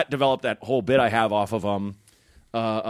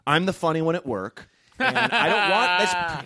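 higher-pitched mock party guest; imitated heckling girl; 5 semitones higher, slower than usual. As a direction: lightly put on a self-important girl. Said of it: "a girl who is like".

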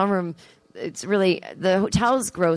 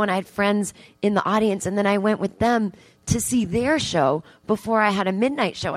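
ordinary talk

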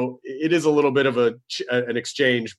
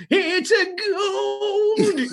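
very high, very slow crazed cry; crazy old prospector; much slower than usual. Pretend. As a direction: wail it like an insane miner. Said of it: "that guy".

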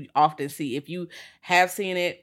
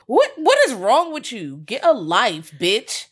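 loud, high, fed-up scolding; exasperated rant; 5 semitones higher, 6 dB louder, slower than usual. Real, not acted. Cohost fed up with cop fantasies, loud exasperated scold. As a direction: tell them off, disgusted.

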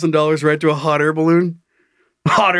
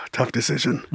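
quiet mock solemn; 4 semitones lower, 7 dB quieter than usual. Friend weighs pulling plug.

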